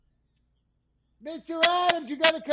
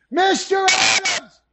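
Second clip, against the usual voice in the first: loud, high, drawn-out call; 7 semitones higher, 5 dB louder than usual. Real, not acted. Fetching Mister Adams from another room, loud call. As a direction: yell the name down the hall.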